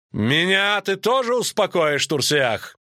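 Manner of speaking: high, drawn-out challenge; Рейнфарн shouting. A narrator's reading. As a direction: call out defiantly to a rival. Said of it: "крикнул, вставая".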